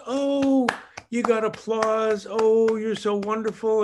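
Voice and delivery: put-on high, gushing applause for a toddler; parent praising baby. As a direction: coo over a baby's first steps.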